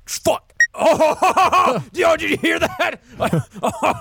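high, loud laughing gloat; laughing excitedly. Real, not acted. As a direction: cackle over the prank. Laughing gloat after prank.

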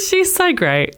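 high, slow gush after a laugh; gushing admiration. Retold or imitated. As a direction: coo it up high, stretched out. Admiring thought repeated with laughter.

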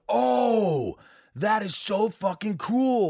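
high, slowed gush of delight; mock thrilled. Horror fan imagining a great movie moment, high slow delighted gush. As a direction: gush like a fan who just got a great scare.

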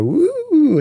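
loud, very high held whoop; high-pitched woo. Shown as a demonstration. Host demonstrates what Discord cuts off, high-pitched woo. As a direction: shoot up high and hold the note. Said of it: "as soon as you go".

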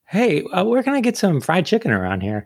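high-pitched imitation Southern accent; mock Southern accent. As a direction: ask it in a playful Southern accent. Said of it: "like Southern. I'd be like".

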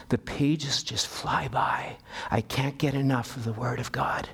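slow, soft, tearful whisper. Retold or imitated. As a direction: whisper it through tears. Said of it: "with tears in his eyes".

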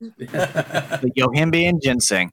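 laughing. Real, not acted. Laughing repeat, slow.

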